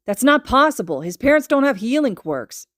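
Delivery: angry, loud disbelief; scowling objection. A narrator's reading. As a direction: shut the idea down. Kacchan scowling, disputing.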